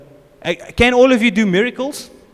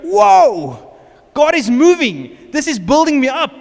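loud, high exclamations of wonder; awestruck excitement; 6 semitones higher, 6 dB louder, slower than usual. Retold or imitated. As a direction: cry out like a newly amazed believer. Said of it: "you're like".

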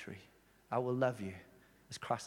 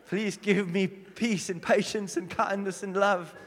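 pleading to God, higher, louder and drawn out; mock desperate prayer; 4 semitones higher, 5 dB louder, slower than usual. Exasperated church leader praying about a difficult member, mock pleading. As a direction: beg slowly for patience, hammed up.

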